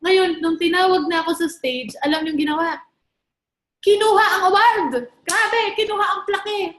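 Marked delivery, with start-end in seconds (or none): 3.8-6.8 excited exclamation. Real, not acted. Mother grabbing award surprisingly.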